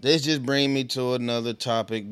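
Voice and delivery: ordinary delivery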